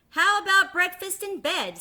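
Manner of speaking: high, loud, impudent ask; second duckling bargaining; cheeky duckling. Acted. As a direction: try your luck with a grin.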